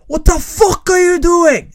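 loud, high, drawn-out British yell; hammed-up British shouting; put-on British accent. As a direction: confront a stranger in mock British. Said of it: "lagt på meg litt sånn britisk aksent".

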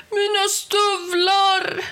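high, drawn-out villain's yell. A narrator's reading. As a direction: bawl it out in outrage. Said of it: "gastade Helgerån".

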